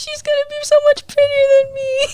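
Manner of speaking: high, laughing fake cry; half-laughing mock cry; mock wail. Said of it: "I was like".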